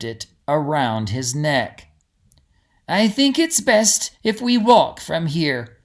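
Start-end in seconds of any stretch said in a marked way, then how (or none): 2.9-5.8 lifted, higher, brighter-sounding reassurance; a gentle guide forcing cheer; trying to sound cheerful. A narrator's reading. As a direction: put on a cheerful front for the group. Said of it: "trying to sound cheerful".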